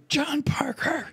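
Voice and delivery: very high, slow outraged name call; mock prim reproach; scolding church lady. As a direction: scold him by full name. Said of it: "And she goes".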